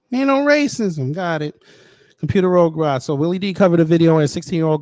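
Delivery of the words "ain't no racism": high, loud sarcastic jab; mock the denial loudly. Real, not acted. Streamer mocking the man's claim of no racism.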